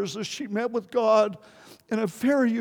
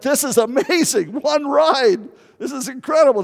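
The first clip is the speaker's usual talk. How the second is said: higher and louder, laughing on "one ride"; retold delight; 6 semitones higher, 4 dB louder than usual. Preacher recalls his hitchhiking luck, mock excited. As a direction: relive the thrill of a lucky break.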